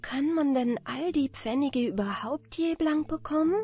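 anxious question. A narrator's reading. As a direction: ask it with a touch of fear. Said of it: "fragte Anna Barbara ängstlich".

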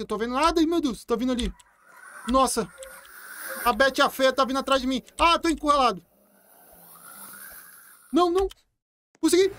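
panicky, high and loud; yelping as they close in; being chased. Real, not acted. Chased by zombie children, cornered.

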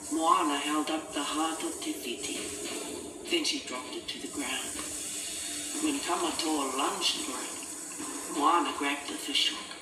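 ordinary delivery, nothing marked

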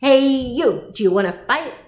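loud hail, starting high; grouchy challenge. A narrator's reading. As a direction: pick a fight like a scrappy ladybug. Grouchy ladybug challenges praying mantis.

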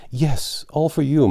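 high, loud happy cry to her sister; joyful cry. Acted. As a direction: cry it out, beaming with joy. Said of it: "cried Joe".

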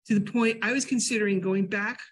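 normal talking voice

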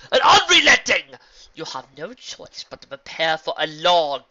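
loud, high evil ruler boasting; cold rasping evil emperor. Acted. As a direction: gloat over your crushed enemies. Villain monologue, power boast.

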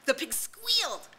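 high, loud eager shouting; excited hunters. A narrator's reading. Hunters breaking in to tell Ralph, high excited shouting. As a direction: crowd in with the story. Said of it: "Voices broke in from the hunters".

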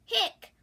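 loud high hiccup sound. Acted. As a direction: hiccup it out sharply. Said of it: "went the cat".

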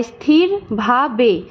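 "স্থির ভা বে" slowly, one syllable at a time; split into syllables. Shown as a demonstration. Teacher showing students how the word breaks, "স্থির ভা বে" syllable by syllable. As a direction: break "স্থির ভা বে" into three separate beats with small gaps. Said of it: "ভাঙতে গেলে কিভাবে উচ্চারণ করি দেখো".

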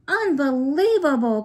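loud, high amazed shout; excited cry. A narrator's reading. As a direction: burst out in delight. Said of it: "cried Omar".

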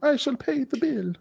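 high-pitched mock store owner voice; Dearborn shopkeeper impression. Host mimics Dearborn store owner vowing to stay open, hammed-up impression. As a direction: put on a high, drawn-out shopkeeper voice. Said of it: "That's how they sound".

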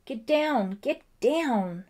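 loud and high, ordering the dog off; shouted command. A narrator's reading. Ellie ordering the muddy dog off her, loud shout. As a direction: push him off with your voice.

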